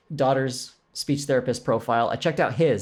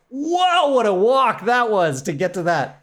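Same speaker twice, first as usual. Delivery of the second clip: big high whoa, then louder; amazed, pitched way up at first; 7 semitones higher, 5 dB louder, slower than usual. Retold or imitated. Narrator reacting to the sash reveal, amazed. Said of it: "And I was like".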